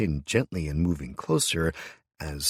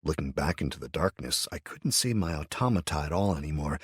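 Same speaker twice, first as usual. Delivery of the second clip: usual speaking voice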